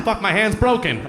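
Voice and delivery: normal talking voice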